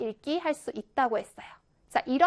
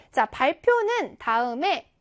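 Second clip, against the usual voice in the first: ordinary talk, nothing marked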